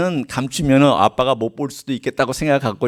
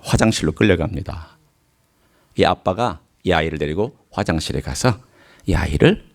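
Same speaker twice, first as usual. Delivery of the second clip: ordinary talk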